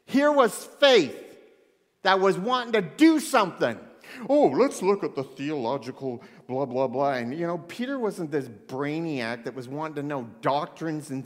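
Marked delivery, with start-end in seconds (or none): 4.3-7.1 put-on pompous scholar; mock theorizing, loud and dismissive. Imitating a brainiac fussing over doctrines, mocking. Mocks brainy theological talk.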